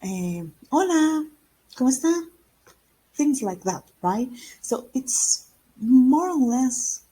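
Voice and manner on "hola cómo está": high, lively, louder Spanish hello; animated, sing-out greeting rising at the end; expressive greeting. Shown as a demonstration. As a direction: greet with a big lift in pitch. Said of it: "you sound like".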